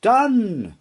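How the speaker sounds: high ringing cry; unicorn's bright call. Acted. Unicorn granting the horse's wish, a high bright cry.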